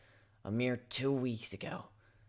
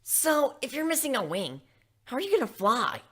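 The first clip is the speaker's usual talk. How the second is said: high, loud blunt question; lightly voiced sporty pony; brash Rainbow Dash; 12 semitones higher, 7 dB louder than usual. Rainbow Dash asking Griff how he'll fly, high and loud. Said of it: "Rainbow Dash asked".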